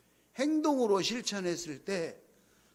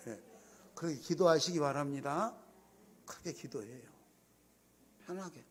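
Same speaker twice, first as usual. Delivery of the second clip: ordinary talk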